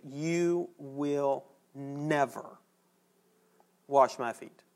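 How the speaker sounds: slow, drawn-out vow with NEVER leaned on; Peter's emphatic never; emphatic refusal. Retold or imitated. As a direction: refuse flatly, stretching "You will never" out. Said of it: "this emphatic".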